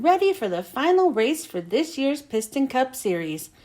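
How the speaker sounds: usual speaking voice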